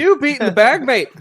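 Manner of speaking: high, loud cheeky Irish lilt; Irish accent. Pretend. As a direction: tease him in a leprechaun brogue. Mocking friend in a put-on voice.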